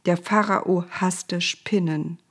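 plain reading voice

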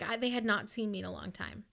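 ordinary talk

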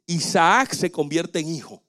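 ordinary talk, nothing marked

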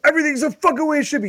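fast, high-pitched exasperated yell; mad screaming. Retold or imitated. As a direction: re-enact yelling at a tech helper in frustration. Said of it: "I'm screaming, I'm getting mad".

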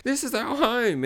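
high, slow, hammed-up declaration; creature's earnest cry, played for comedy; mock hobgoblin plea. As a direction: stretch it out high and plaintive. Voices hobgoblins fleeing back.

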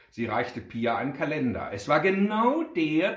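ordinary delivery

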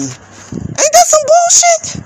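high, slow mock-disbelief question; incredulous squeal. Real, not acted. Ranting to viewers about veterans, a high incredulous squeal. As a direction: squeak it out, outraged and sarcastic.

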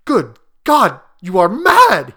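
shocked, appalled outburst; aghast. A narrator's reading. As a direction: cry out in horror. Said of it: "he cried aghast".

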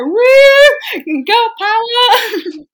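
high, loud, drawn-out whoop; mock triumphant girl-power holler; whooping cheer. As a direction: shout it like a victory cry, hammed up.

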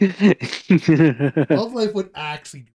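words squeezed out through laughter; laughing. Real, not acted. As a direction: laugh through the line, slowly. Laughing over a funny idea.

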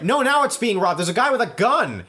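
frantic alarm. Acted. Acted frantic second alarm.

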